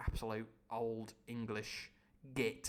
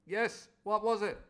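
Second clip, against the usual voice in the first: plain reading voice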